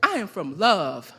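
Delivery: loud, emphatic live poetry recital with measured pauses; spoken-word poem. Acted. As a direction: declaim it line by line, letting each phrase land.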